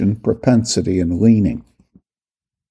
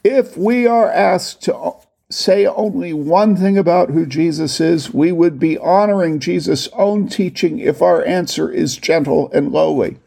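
raised higher and slowed, every word leaned on; read with italic emphasis; 6 semitones higher than usual. Shown as a demonstration. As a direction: lift the whole sentence as if italicized. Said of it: "The first sentence is all emphasized in italics".

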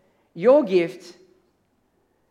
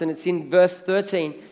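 normal talking voice

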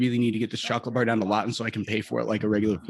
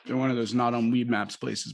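ordinary talk, nothing marked

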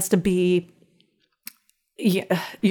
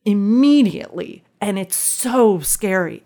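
ordinary delivery

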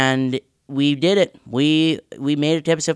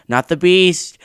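calling out; 5 semitones higher, 7 dB louder than usual. Acted. Show intro catchphrase call.

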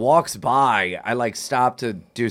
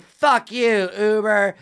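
put-on higher, slow slurred drunk yell; mock a drunk woman ordering him around; drunk girl quote; 7 semitones higher, much slower than usual. Retelling a drunk passenger scolding the driver, mock drunk demand. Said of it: "And she's like".

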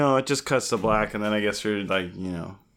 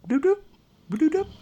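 phone ringtone; 4 dB louder than usual. Retold or imitated. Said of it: "Her phone's ringing".

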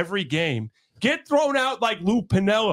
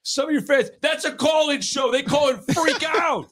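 heated rant; 6 semitones higher than usual. Real, not acted. Host ranting at his cohost about fans, heated. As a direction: get fired up about the fans.

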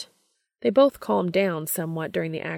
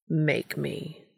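low, rough challenge; rough dare, voice dropped low. Acted. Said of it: "voice low and rough".

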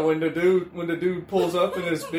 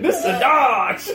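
meme voice; 6 semitones higher, 6 dB louder than usual. Retold or imitated. Meme quote said loud, laughing.

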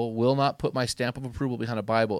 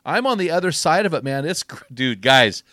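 louder, higher, rousing sermon voice; fired-up preaching; 6 semitones higher, 7 dB louder than usual. Acted. Podcast host rallying men to finish strong, fired-up preaching.

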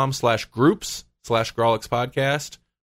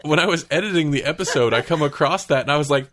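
ordinary talk

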